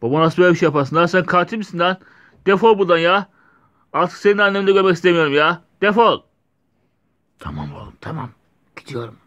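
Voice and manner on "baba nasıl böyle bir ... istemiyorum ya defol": loud, high, furious at his father; betrayed son screaming at dad; angry shouting. Acted. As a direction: yell him out of the house. Son confronts killer father.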